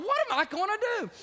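high, fast, panicked cry; frightened boy at the ravine's edge, high and fast; scared out of his mind. Retold or imitated. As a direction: blurt out the panic. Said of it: "scared out of his mind".

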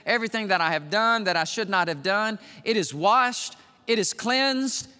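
building from firm to shouting high and loud; preaching at full force. Acted. Preacher to congregation, building to a full shout. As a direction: preach it up to a roaring peak.

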